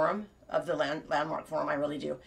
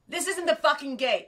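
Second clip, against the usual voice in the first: mock rude sign; 8 semitones higher, 4 dB louder than usual.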